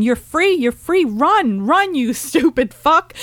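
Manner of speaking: urging cows on. Retold or imitated. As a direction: shoo the cows out, half laughing. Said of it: "they were talking to the cows".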